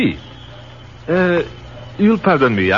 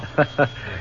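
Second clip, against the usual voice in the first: breathy soft laugh; 3 semitones higher, 6 dB quieter than usual. Acted. Quiet laugh at army troubles.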